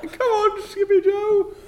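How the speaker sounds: high distant call. Acted. Calling from far up.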